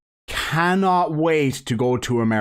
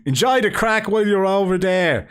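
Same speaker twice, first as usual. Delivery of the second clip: high, hammed-up Dublin accent; exaggerated Dublin accent, raised pitch; 6 semitones higher than usual. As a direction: sign off in a broad mock Dublin accent. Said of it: "have to read this in a Dublish accent".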